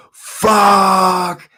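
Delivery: high, loud drawn-out yell; yelled curse. Retold or imitated. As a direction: re-enact a frustrated scream of the curse. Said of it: "I just yelled".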